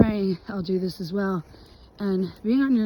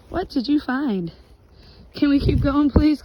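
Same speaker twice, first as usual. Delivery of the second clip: raised pleading call to the dog; 6 semitones higher, 6 dB louder than usual. Real, not acted. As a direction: call the dog along, louder and higher. Calling to the dog Sam.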